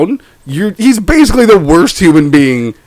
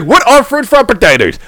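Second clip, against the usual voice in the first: higher-pitched mock puzzled question; puzzled questioner; 4 semitones higher than usual. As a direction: ask it high, as the baffled straight man. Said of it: "he's like".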